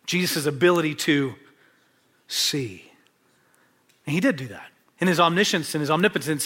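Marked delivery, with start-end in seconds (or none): none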